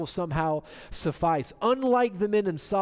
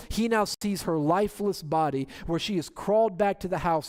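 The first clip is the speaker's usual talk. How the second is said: ordinary talk, nothing marked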